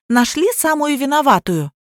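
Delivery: high-pitched indignant protest. A narrator's reading. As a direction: protest at once, pitched well up. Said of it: "немедленно возмутилась я".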